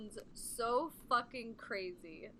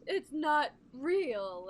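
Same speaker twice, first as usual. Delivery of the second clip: slow, louder mocking "it's not real"; mocking dismissal; 4 semitones higher, 6 dB louder, much slower than usual. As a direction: drag it out to mock her denial. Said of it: "she's like".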